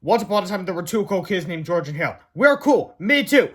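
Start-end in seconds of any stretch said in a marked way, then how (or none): 2.3-3.0 put-on kid voice. Kids' comic caption voiced. 3.0-3.5 put-on kid voice. Kids' comic caption voiced.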